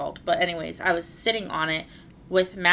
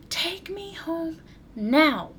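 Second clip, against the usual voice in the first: put-on much higher, very slow, childish demand; 11 semitones higher, much slower than usual. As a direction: drag it out like a bossy little kid. And then he goes.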